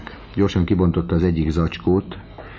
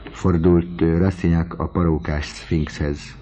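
ordinary delivery, nothing marked

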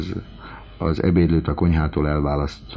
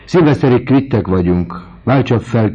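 raised voice; 6 semitones higher, 6 dB louder than usual. Acted. Rastignac pays off the widow.

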